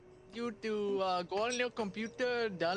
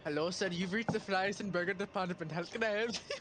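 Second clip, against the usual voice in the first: mock operator; 3 semitones lower than usual. Mock fries department operator.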